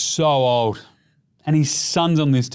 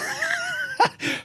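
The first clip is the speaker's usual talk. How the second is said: a very high squealing burst of laughter; shrieking laugh; 6 dB quieter than usual. Real, not acted. Host shrieks with laughter at the coffee order.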